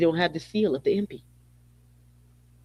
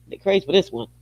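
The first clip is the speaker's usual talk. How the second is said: ordinary delivery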